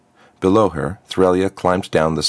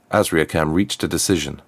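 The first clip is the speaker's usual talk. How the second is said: usual speaking voice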